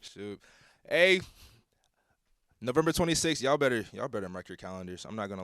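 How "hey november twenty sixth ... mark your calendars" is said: hyped up. Real, not acted. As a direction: holler it out to rally the fans. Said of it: "I'm, I'm hyped".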